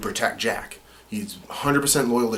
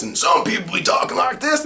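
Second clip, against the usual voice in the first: much higher, loud, half-shouted sing-song voice; different character voice; 14 semitones higher, 5 dB louder than usual. Shown as a demonstration. The voice actor showing his range, a loud sing-song voice. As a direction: belt it like a rowdy soldier. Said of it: "a different dialect, a different voice".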